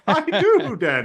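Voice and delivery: squeaking with laughter; repeating the joke to his friend, laughing high. Retold or imitated. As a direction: crack up as you say it.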